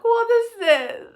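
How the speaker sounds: high, loud wailed question; outraged disbelief. Real, not acted. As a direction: cry out in exasperation at the dish. Outraged at the bad dish.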